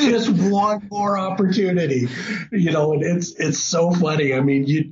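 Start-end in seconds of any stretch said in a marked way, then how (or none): 0.1-2.1 higher-pitched hopeful plea; begging. Retold or imitated. Hunter begging for just one more chance, pleading. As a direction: plead for one last shot. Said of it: "begging for just one more chance".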